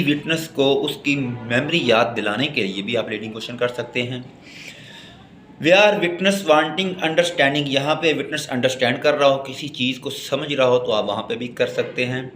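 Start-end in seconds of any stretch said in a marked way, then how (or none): none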